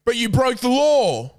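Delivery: loud, high, drawn-out protest; mock outrage. Scolding an arrested lawbreaker, loud mock outrage. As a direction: shout it slowly in exasperation.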